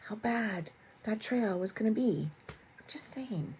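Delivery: conspiratorial whisper; whispered aside. Real, not acted. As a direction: whisper it like a secret jab.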